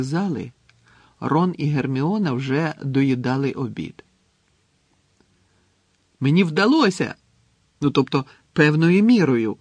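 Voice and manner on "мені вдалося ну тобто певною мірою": louder, raised, happy delivery; joyful announcement. A narrator's reading. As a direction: proudly share good news. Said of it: "радісно повідомив Рон". (радісно повідомляє)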